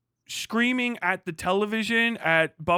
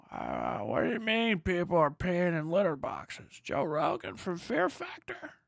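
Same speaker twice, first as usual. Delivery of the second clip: mock confused viewer; 6 dB quieter than usual.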